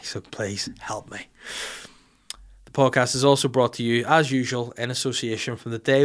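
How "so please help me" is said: hushed, slow begging; mock plea. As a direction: beg softly and slowly for help. Comic plea in sponsor read.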